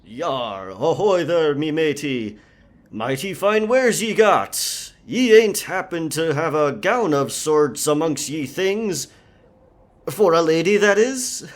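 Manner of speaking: put-on over-the-top pirate accent; hammed-up pirate speak. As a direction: play a bad stage pirate to fool the merchant. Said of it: "the pirate speak".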